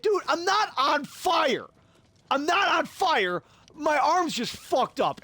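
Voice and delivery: high, slow, emphatic acted protest; exasperated retort. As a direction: spell it out to someone overreacting. Acting out argument with his brother.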